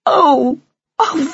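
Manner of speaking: high, loud wailing ohs; upset young mouse's cry; distressed wail. Acted. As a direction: wail in dismay. Flora distressed at father's injury.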